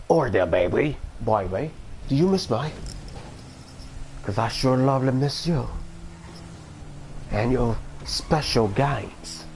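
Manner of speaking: badly faked Australian accent; horrible accent. Crooning to a baby they missed, in a horrible Australian accent. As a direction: do a terrible mock Aussie accent. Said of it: "that was a horrible accent".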